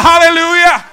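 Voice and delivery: high, loud praise shout; ringing pulpit call. Real, not acted. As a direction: rouse the congregation with a holler. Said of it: "Somebody shout".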